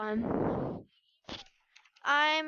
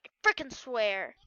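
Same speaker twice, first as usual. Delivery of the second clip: ordinary talk, nothing marked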